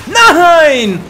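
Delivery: high, loud cry of dismay; real annoyed shout; frustrated yell. Real, not acted. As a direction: blurt it as he dies. Killed again mid-sentence.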